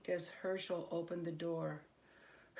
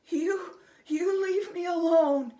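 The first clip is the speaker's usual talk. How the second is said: much higher, frightened goblin squeak; 10 semitones higher, 10 dB louder than usual. A narrator's reading. Scared goblin begging Herschel, high squeaky fear. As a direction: plead in a tiny terrified squeak. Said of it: "he squeaked".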